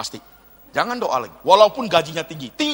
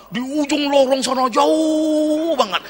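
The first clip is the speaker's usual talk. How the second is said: mock drawn-out; 7 semitones higher, slower than usual. Mocking secluded corner.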